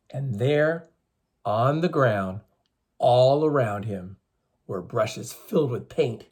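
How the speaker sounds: slow, expressive storyteller voice; story reading for children. A narrator's reading. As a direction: read the legend to the class, drawn out. Teacher reading legend to children.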